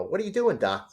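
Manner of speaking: high, loud Brooklyn cartoon rabbit; Bugs Bunny voice. Retelling his storyboard pitch, doing Bugs high and loud. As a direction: toss it off like a wisecracking rabbit. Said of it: "I did Bugs talking to Beaky".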